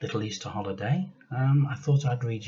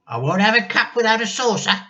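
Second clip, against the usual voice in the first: put-on higher, louder, bossy old woman; grandma demands tea from George, bossy and cranky; 13 semitones higher, 8 dB louder than usual. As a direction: order the boy about like a cranky old lady. Grandma voiced in story.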